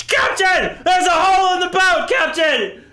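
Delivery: high, loud desperate crew cries; panicked shouting. Acted. Crew begs the captain to land the ship, loud and high. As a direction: scream over the flooding hull.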